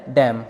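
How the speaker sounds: डैम ending on M; silent final N. Shown as a demonstration. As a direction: say डैम with no N after the M. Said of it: "एन silent रहेगा".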